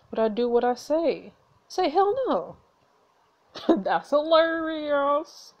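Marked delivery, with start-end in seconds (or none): 1.7-2.6 high, emphatic hell no. Real, not acted. Urging refusal in game. 3.8-5.5 slow, laughing through it. Real, not acted. Laughing at game text.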